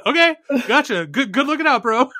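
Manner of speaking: high, squeaky Elon giving in. Retold or imitated. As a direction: squeak out an eager agreement. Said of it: "Elon giving in".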